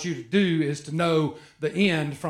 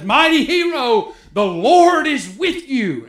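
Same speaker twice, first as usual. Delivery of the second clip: angel's greeting; 5 semitones higher, 5 dB louder than usual. Acted. Angel greets Gideon, read aloud.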